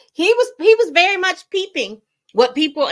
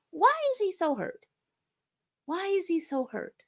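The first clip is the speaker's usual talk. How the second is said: puzzled; 8 semitones higher, 6 dB quieter than usual. Real, not acted. Puzzled over the rapper's pain.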